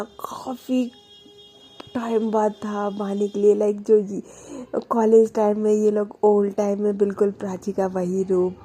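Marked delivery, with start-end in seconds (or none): none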